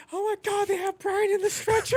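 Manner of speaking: put-on very high panicked girlfriend voice; shrill girlfriend impression. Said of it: "My friend's girlfriend's like".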